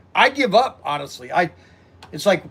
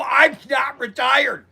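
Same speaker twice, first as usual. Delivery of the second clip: indignant protest; 5 semitones higher than usual. Retold or imitated. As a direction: protest hard that you're not retired. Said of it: "I go".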